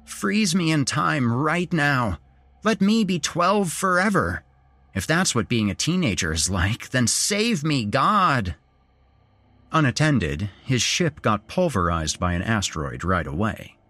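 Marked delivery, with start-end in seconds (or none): 0.0-8.5 half-joking prayer to the sky; mock prayer. A narrator's reading. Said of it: "he prayed aloud".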